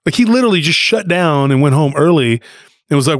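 ordinary delivery

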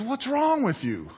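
high-pitched exasperated question; acted telling-off of a dog; scolding dog owner. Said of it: "looks at the dog and says".

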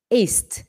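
"Ist" as one crisp syllable; correct pronunciation. Shown as a demonstration. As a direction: model "Ist" ending sharply on t. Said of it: "é ist". (pronúncia correta de "Ist")

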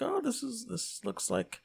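high-pitched, light gushing quote; thrilled reaction. Retold or imitated. Host retells watching the Venom trailer, high delighted gush. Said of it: "I was like".

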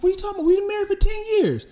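very high, fast mock-wife protest; indignant wife. Wife of ten years answering her friend, indignant. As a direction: protest in a squeaky-high put-on voice.

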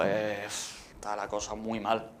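soft, low, under his voice; subdued worried thought. Retold or imitated. Guest recalls thinking before going onstage, subdued worry. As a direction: retell a private worry quietly.

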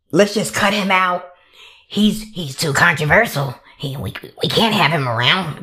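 low, stuffy mock official; mock objector. Executives deciding to cut Pepe, mocking low voice.

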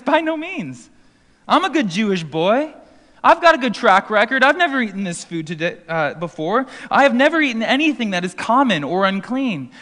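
mock indignant refusal; imitating defensive Peter. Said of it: "And Peter says".

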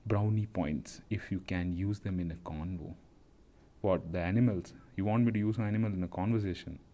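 usual speaking voice

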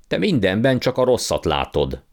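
put-on an uncle's impatient retort to the boy. A narrator's reading. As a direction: cut him off impatiently. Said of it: "vágta rá türelmetlenül".

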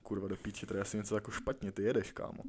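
low, quiet muttered aside to yourself; retold worried thought, kept soft and low; inner thought. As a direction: think it aloud under your breath. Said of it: "si řekneš v té hlavě".

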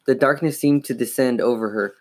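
plain reading voice

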